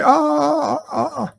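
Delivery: high, hammed-up put-on accent; mock waking confession in an affected accent; badly faked accent, starting high and groaning awake; Picard. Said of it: "that's why I have this accent".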